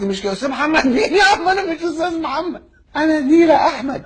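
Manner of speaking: very high-pitched comic indignation; hammed-up exasperation at the wrong name; exasperated mock protest. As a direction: squeal out the correction, half laughing.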